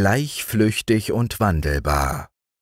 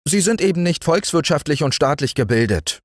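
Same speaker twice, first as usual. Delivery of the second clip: high, fast, prim ant voice; 9 semitones higher, faster than usual. A narrator's reading. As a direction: lecture the mayfly as the ant. Ant lecturing.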